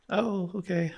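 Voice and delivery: high, uneasy mock "oh, okay"; awkward brush-off. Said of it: "And he's like".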